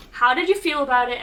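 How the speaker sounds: ordinary delivery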